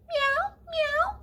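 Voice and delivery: very high squeaky meow; kitten mew. Acted. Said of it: "like you're a curious little kitten".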